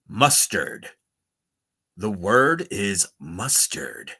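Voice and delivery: slow, low mock announcer; radio voice. Streamer announces a silly word to chat, slow radio voice. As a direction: intone it slowly like a radio host. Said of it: "in a radio voice".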